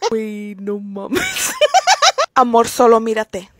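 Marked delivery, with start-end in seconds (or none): 0.1-1.5 slow, laughing through the words. Real, not acted. Creator reacts to meme, slow laughing. 1.6-2.3 high burst of laughter. Real, not acted. Reaction laugh.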